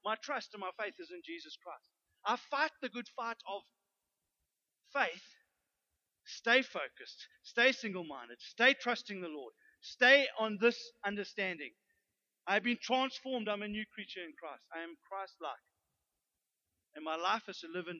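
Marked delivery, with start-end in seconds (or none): 0.0-11.7 loud, slow-building pulpit exhortation; a loud, slowed sermon call to stay focused; full-force preaching. Acted. 12.5-14.6 loud and slowed; preached declaration. Acted.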